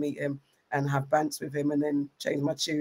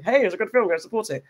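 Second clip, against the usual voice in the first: put-on, higher-pitched plug; forced enthusiasm; 5 semitones higher, 3 dB louder than usual. Imitating someone forced to plug a film, forced enthusiasm. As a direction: fake a keen endorsement. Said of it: "sometimes you get forced to".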